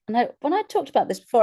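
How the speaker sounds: ordinary delivery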